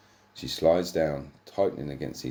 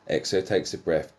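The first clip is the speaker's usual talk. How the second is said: normal talking voice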